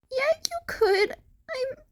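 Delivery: put-on high, tearful boyish voice breaking; crying confession. A narrator's reading. The crying boy confesses his lies to Shinso, tearful. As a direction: sob out the guilty secret.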